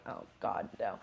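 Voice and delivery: breathy "Oh God, no" under the breath; whispered dread. Retold or imitated. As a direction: hush it out in dismay. Retold panic over stomach cramps.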